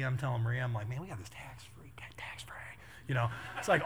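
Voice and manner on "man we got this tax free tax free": hushed stage whisper; conspiratorial retold whisper; gleeful whisper. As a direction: whisper it like a shared secret. Retells secretly sharing the tax dodge.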